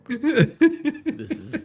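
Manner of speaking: high, slow, dissolving into laughter; can barely get the words out for laughing; stretched giggly words. Real, not acted. Cracking up at innuendo.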